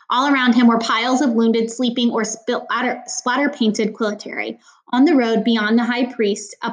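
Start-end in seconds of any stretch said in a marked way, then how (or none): none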